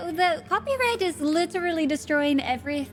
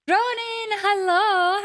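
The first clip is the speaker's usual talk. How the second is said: excited greeting; 7 semitones higher, 4 dB louder, slower than usual. Real, not acted.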